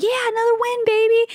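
high-pitched mock victory whoop; gloating cheer. Podcaster posting about the Suns' win, gloating cheer. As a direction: crow it like a celebrating fan.

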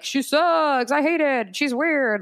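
high-pitched mocking imitation; mocking haters. Mimicking online haters' comments.

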